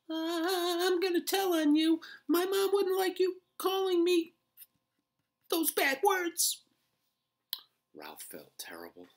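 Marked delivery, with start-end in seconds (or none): 0.1-6.6 high, loud whiny threat; tattling little mouse. A narrator's reading. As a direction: tattle like a small child. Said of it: "his voice filled with reproach".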